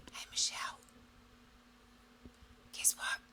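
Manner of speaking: soft, breathy whisper; body whispering. Pretend. Her body whispering a first warning to Michelle. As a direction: whisper it like a faint hint.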